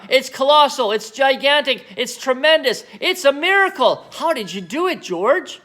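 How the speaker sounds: high, loud thrilled voice; excited. Acted. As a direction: rave in amazement. Cranky excited.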